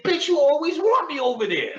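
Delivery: high, loud laughing retort; loud mock comeback; yelling back. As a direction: shout it back at her, half laughing. Arguing back at her, retold.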